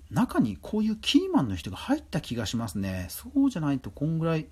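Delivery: ordinary talk, nothing marked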